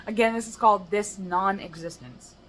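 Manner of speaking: normal talking voice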